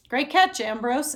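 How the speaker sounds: loud happy bellow; bellowed praise. A narrator's reading. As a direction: bellow it across the street to your friend. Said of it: "Aaron bellows".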